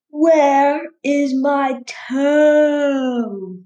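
slow, drawn-out moaning ghost voice; ghostly groan.

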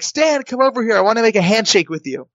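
high, eager call, easing down; calling someone over. Retold or imitated. Hopeful contestant calls the producer back, high eager call.

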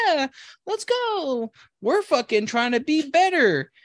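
high, drawn-out eager cheer; mock enthusiasm. Friends agreeing to go to the gym, exaggerated eagerness. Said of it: "And we were like".

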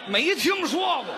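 loud, slow, high indignant cry; mock protest. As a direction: protest in exaggerated disbelief. Partner protesting. (夸张地大声抗议)

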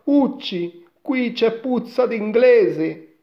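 put-on high, loud boy's version of the giant; boy quoting giant. A narrator's reading. Boy reports the giant's words to mother, high and loud. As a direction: repeat the ogre's words in a frightened boy's pitch.

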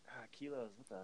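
very quiet mock puzzling-out; baffled near-silence. Host imitating Americans silently stumped by kilos, baffled and hushed. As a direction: mumble it under your voice as if stumped. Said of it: "we're all silent over here going like".

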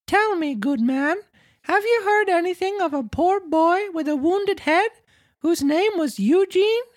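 very high puppet voice; Pinocchio asking politely. Acted.

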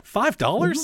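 high incredulous question; can't believe the price; amazed. Real, not acted.

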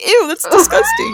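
high shrieking grossed-out voice; mock disgusted shriek. Imitating friends shrieking in disgust at her back. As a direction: squeal it like a grossed-out kid.

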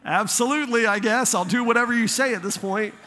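a hammed-up eager reply to a request; mock eager agreement. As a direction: play it as a flustered, willing yes. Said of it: "And I'm like".

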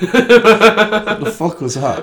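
high, loud, incredulous laughter; slow, drawn out through real laughter; laughing. Real, not acted. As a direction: barely get the question out for laughing. Cracks up at co-host's botched metaphor.

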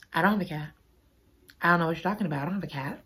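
low, soft feigned innocence; deadpan denial. Retold or imitated. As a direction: deny it quietly, as if nothing's there. Chloe's deadpan denial repeated.

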